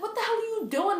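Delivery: high shocked question; alarmed friend. Retold or imitated. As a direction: cry out in disbelief, up high. Friend sees her forcing vomit.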